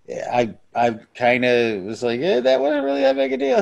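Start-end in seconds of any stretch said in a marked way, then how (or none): 2.2-3.6 high-pitched dismissive quote; dismissive shrug. Retold or imitated. Podcaster retelling his own first reaction, mock dismissive. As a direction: brush it off in a raised, squeaky pitch.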